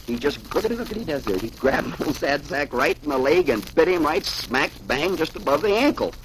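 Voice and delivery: ordinary delivery, nothing marked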